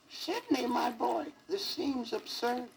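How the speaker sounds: put-on high squeaky old fairy voice. A narrator's reading. Fairy godmother squeaking down at the sad elephant. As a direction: squeak it out like a tiny old lady. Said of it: "squeakily said".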